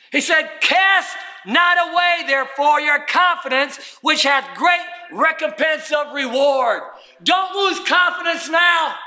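high, urgent pulpit exhortation; full-force preaching. Acted. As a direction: preach it out to rally the congregation.

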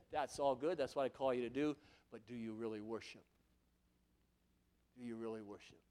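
low, soft searching question; soft God. Acted. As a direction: ask it gently as God. God asking gently.

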